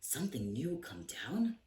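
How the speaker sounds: breathless shocked whisper; whispered gasp of a startled page boy. Acted. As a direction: gasp it out in disbelief. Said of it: "Bartholomew gasped".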